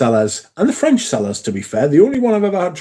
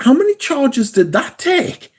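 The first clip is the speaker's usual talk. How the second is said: much higher, drawn-out incredulous question; retold amazement; 12 semitones higher than usual. Retelling reaction to the Ukrainian Tesla's long drive, amazed. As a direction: ask it in high-pitched disbelief, stretching the words.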